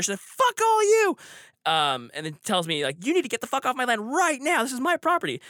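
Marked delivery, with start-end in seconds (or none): 0.2-1.2 high, loud furious yell; drunken outburst, pitched way up and loud; mimicked screaming. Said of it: "she's just screaming and screaming". 3.1-5.4 higher-pitched imitation of an irate landowner; toned-down version of her tirade, pitched up; retold indignant order; angry demand. Teller voices the angry woman's order.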